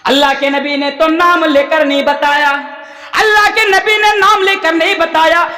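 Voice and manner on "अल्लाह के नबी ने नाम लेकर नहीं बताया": higher, ringing preacher's proclamation; emphatic repetition. Real, not acted. Preacher insisting no names were given, emphatic proclamation. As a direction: hammer the point home, pitched high. Repeats the point with force.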